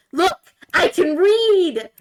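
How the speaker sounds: "read" held long and high, slow and loud; excited. Retold or imitated. Frog showing off it can read, "read" drawn out high. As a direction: stretch out "read" with delight. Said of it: "really excited frog".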